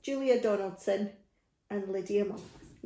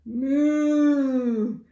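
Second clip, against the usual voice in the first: long drawn-out moo, a little louder; cow mooing; 3 semitones higher, 5 dB louder than usual. Acted. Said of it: "the cow said".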